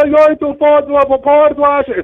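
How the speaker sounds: high, loud, slow insistence; haughty passenger. Retold or imitated. Passenger insisting on her destination, high and loud. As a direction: lean on the name like he's slow. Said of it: "she goes".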